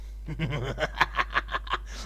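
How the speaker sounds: scheming villain laugh; mischievous chuckle. Acted. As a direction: chuckle while plotting a petty theft. Gloats before robbing badger.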